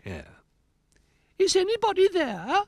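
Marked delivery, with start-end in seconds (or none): 1.4-2.6 very high, loud put-on falsetto; Goldilocks voice. A narrator's reading. Rabbit playing Goldilocks calls at the door, Goldilocks voice. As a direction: call out as a squeaky Goldilocks. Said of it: "he called in his Goldilocks voice".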